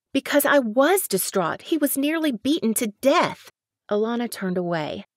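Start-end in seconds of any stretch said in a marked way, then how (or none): 0.1-3.5 higher and louder, offended; indignant protest. Acted. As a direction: snap back in disbelief.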